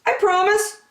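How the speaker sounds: high, eager little fox voice; fox promising. A narrator's reading. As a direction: promise sweetly to behave. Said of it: "said the fox".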